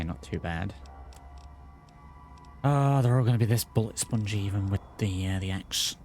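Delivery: ordinary talk, nothing marked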